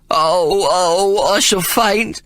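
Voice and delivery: high, whining panic; a cowardly man near fainting at blood; hysterical sobbing. A narrator's reading. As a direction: wail as if about to swoon. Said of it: "he sobbed".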